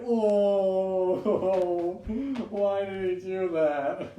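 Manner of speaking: high, loud mock whine; hammed-up high complaint; mock guy voice. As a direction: squeak it out like a silly guy. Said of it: "He was like".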